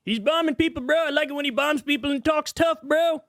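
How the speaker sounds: mock a Trump fan cheering him on; hammed-up bro voice; mocking fanboy. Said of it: "He just".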